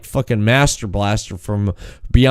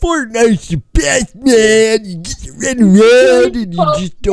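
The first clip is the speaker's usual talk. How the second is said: much higher, slow, put-on dopey fan voice; mock Fortnite fan; 10 semitones higher, 3 dB louder than usual. Making fun of Fortnite players, mock dopey fan. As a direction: ridicule a superfan gushing.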